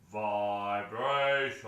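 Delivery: slow, drawn-out deep voice; big deep voice. Shown as a demonstration. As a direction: stretch it out low and slow. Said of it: "in a big deep voice everybody go".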